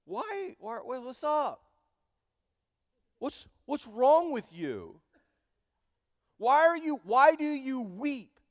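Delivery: high, loud, baffled husband voice asking his weeping wife what's wrong; mock clueless husband. Elkanah pestering his crying wife, in a high mock-baffled voice. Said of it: "And look at what he says".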